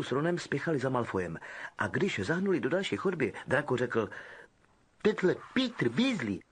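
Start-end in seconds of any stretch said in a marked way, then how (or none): 5.0-6.4 mild sneer; a hint of spoilt sneer; Malfoy mocking Percy's name. Malfoy voiced.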